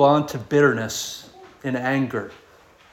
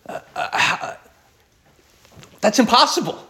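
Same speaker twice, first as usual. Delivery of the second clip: flustered stammer, then a higher protest; mild complaint; 7 semitones higher than usual. Acted. Disciples answering Jesus about the rich young ruler, mildly complaining. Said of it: "in complaining about".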